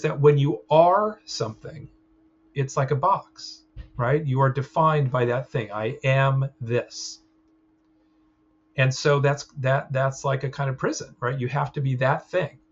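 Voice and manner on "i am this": put-on slow child voice. Child voiced taking label.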